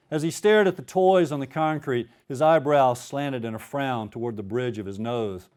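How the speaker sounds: ordinary talk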